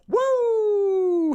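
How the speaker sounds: high, drawn-out "woo"; excited whoop. Real, not acted. Host kicking off the show, excited high whoop. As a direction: let out a long high whoop.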